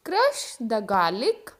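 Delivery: crush the garlic said very slowly; slow model sentence. Shown as a demonstration. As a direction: drill it slowly for the class. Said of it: "example देखो". (धीरे-धीरे उच्चारण)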